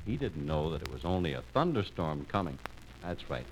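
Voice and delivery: low, soft, kindly aside; sympathetic storytelling. A narrator's reading. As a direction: tell it gently and low. Narrator soothes after the mishap.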